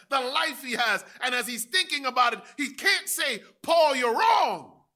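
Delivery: loud, high, climbing; full-force preaching. Acted. Preacher imagining King Agrippa's dilemma, shouting. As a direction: build to the shout.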